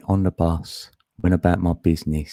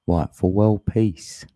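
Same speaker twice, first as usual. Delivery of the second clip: slow and deliberate; 3 semitones higher, slower than usual. Shown as a demonstration. To the chat, sparking up, said slowly. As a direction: stretch out for world peace slowly. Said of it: "I'm saying it like that".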